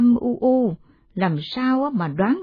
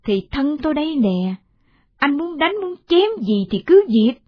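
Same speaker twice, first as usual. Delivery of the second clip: high-pitched defiant challenge; heated, raised-pitch retort; 6 semitones higher, 3 dB louder than usual. Acted. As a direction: dare the husband, pitch climbing. Wife defies husband's threat.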